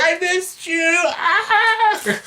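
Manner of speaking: high Wookiee wail with a laughing cry; mock Chewie sobbing his reunion, high. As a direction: whimper like a weepy Wookiee. Voicing Chewie's reply.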